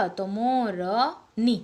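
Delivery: मोरनी said very slowly, leaning on नी; slow teaching demo. Shown as a demonstration. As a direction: stretch मोरनी for the class. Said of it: "वैसे ही मोर".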